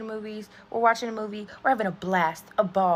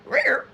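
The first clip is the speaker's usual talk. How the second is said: imitated record scratch; 5 semitones higher, 7 dB louder than usual. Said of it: "that sound where it's like".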